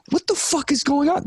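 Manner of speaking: high, disbelieving what the fuck; incredulous outburst. Real, not acted. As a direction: throw up your hands in disbelief. Outraged rant about TikTok.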